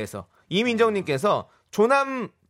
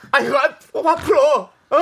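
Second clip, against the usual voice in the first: excited shout; 7 semitones higher, 5 dB louder, slower than usual. Acted. Playful scuffle in the studio.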